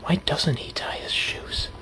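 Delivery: slow, hushed, puzzled whisper. Pretend. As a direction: wonder aloud in secret. Puzzling alone after the story.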